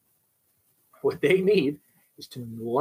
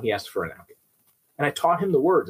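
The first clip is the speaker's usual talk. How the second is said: normal talking voice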